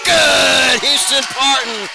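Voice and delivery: high, loud, stretched-out call of the score; big three-point call; excited shout. Real, not acted. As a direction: let it fly as the three drops.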